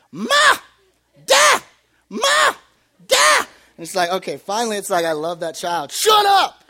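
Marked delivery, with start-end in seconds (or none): 0.1-3.5 put-on high loud baby shout of Da; little boy yelling for his dad; toddler calling out. Son calling from his crib, loud baby shout. 5.9-6.6 loud high playful shout; mock yell. Tired parent to crib, mock yell. Parent joking at baby monitor.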